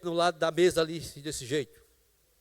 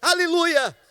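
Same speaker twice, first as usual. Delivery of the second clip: louder and louder, ending in a sung shout; preaching at full force; 4 semitones higher, 10 dB louder, slower than usual. Acted. Preacher assuring the congregation, rising to a loud sung shout. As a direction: build the preaching up until it breaks into song.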